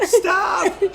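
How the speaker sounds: very high, loud imitated shriek; hammed-up scream through laughter; mock scream. Son imitating mom begging him to stop, mock scream. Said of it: "but I was screaming".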